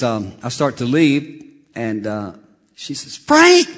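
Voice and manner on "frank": high, loud yell of a name; shrieked call across the room; imitated scream. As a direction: holler it like the receptionist. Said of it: "screams my".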